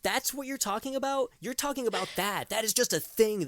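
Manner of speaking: exasperated rant. Real, not acted. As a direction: vent disbelief at the director. Ranting about the making-of.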